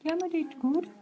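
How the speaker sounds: high sing-song voice with rising Gemma. Pretend. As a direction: lilt it up high, playfully. Repeats name playfully.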